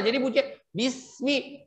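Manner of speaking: bismi said softly with a thin, light s; thin sin. Shown as a demonstration. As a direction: bismi with lips drawn back, thin s. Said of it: "betul-betul membacanya dengan tipis".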